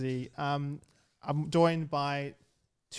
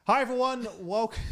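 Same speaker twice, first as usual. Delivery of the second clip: raised, louder podcast greeting; bright host intro; 6 semitones higher, 4 dB louder than usual. Acted. Host opening the podcast to listeners, upbeat greeting. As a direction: launch the show like a presenter.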